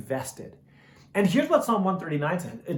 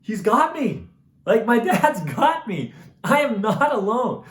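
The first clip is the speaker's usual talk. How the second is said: joyful realization; 5 semitones higher, 5 dB louder than usual. Retold or imitated. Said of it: "there was such a sense of".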